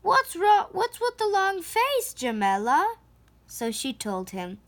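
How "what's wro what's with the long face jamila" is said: lightly voiced fruit seller. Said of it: "he asked".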